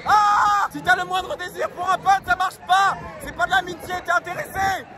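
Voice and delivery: high, slow shouted answers; shouting on a ride. Real, not acted. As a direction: yell the answer over the ride. Réponses criées sur le manège. (crier sur le manège)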